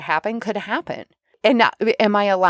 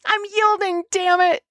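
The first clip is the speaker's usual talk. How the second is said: much higher, frustrated "I'm yielding, damn it"; exasperated yielding; 13 semitones higher than usual. Retold or imitated. Mocking her own forced surrender, high frustrated. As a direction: yield through clenched effort. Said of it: "that's me".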